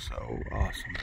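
breathless whispered wonder; awed whisper. Real, not acted. Hiker gazing at the view from the knob, whispering in awe. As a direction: hush it as if the view took your voice.

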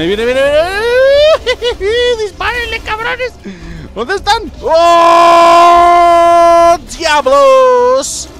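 high, loud screaming for help; panicked shriek. Real, not acted. Gamer as the ghost charges, shrieking at his teammates. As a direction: shriek at the team to shoot.

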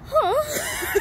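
high, loud startled huh; shocked huh. Retold or imitated. Reacting to the nurse's order to pee in a cup, shocked. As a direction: squeak it out in disbelief. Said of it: "I was literally like".

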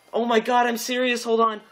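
ordinary delivery

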